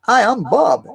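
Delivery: high, loud goofy mimic of Bob; lightly mocking a dim guy introducing himself; dopey sing-song Bob imitation. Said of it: "they're like fucking Bob".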